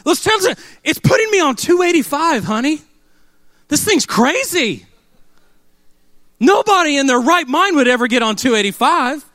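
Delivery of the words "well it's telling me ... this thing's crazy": exasperated husband. Retold or imitated. Husband complaining to wife about the freeway route. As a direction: protest the route in disbelief. Arguing with wife over app.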